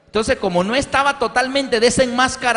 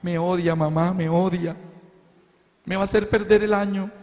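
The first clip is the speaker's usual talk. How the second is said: lightly voiced complaining girl; a hint of a teenage daughter whining to mom; daughter's voice. Daughter complains to her mother about the teacher, lightly voiced.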